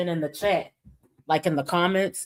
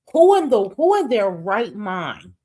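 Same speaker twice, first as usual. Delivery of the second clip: exasperated outburst; 3 semitones higher, 6 dB louder than usual. Real, not acted. Ranting at the streamer, an exasperated louder burst. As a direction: blurt it out, fed up.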